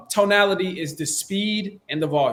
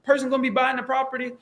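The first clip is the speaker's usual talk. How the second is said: high-pitched mock questioning; exaggerated high voice of a doubting caller; silly questioning seller; 8 semitones higher, faster than usual. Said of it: "they asking you silly things like".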